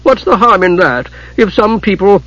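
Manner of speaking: high-pitched whining excuse. A narrator's reading. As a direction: whine in self-defence. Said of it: "Hidjukel whined".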